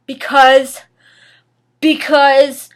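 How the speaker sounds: loud, drawn-out repeated word; a theatrical hesitation; dramatic stalling. Acted. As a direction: hold the suspense loudly.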